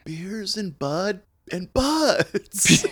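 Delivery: very slow stoned drawl; stoner drawl. Real, not acted. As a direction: drag it out lazily, stoned.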